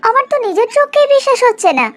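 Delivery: hoch und ungläubig staunend. Acted. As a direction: als traue sie ihren Augen nicht. Visitor amazed at changed village.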